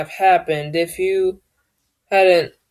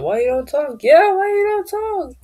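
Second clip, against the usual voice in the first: mock classmate; 11 semitones higher than usual. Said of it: "somebody else might say".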